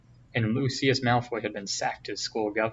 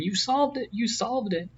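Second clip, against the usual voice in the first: much higher, squealing with joy; excited screaming; 11 semitones higher than usual. A narrator's reading. Girl running to her friend, squealing much higher in joy. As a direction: shriek it high as you run to him. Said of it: "Hermione running towards him screaming".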